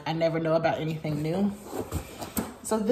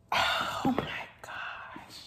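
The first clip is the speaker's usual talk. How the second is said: slow breathless whisper; awed whisper; 6 dB quieter, much slower than usual. Real, not acted. Touched by a friend's gift, awed whisper. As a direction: whisper it in awe.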